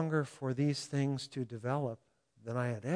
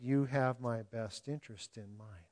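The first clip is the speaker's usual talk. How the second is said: ordinary talk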